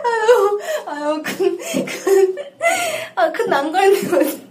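high, cracking up; laughing. Real, not acted. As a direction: giggles breaking the words. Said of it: "갑자기 왜".

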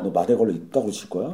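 low, muted scolding; cautious scolding. Acted. Private to recruit while cleaning in a corner, cautious low scolding. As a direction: keep the telling-off down, carefully. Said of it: "조심스럽게 얘기하면".

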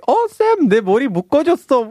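high-pitched, rapid gushing; mock delight. Guest re-enacting joy at having hair tied, mock delight. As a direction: imitate a thrilled squeal, ending in a long wow.

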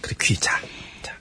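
hushed secret whisper. Acted. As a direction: lean close and whisper it. Leans in to hear a secret. (비밀스럽게 속삭이며)

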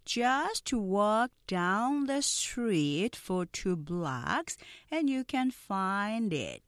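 very slow, drawn-out English; deliberate slow sentence for repeating; slow-speed model. Shown as a demonstration. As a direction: stretch each word so learners can follow. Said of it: "먼저 느린 속도 발음 듣고".

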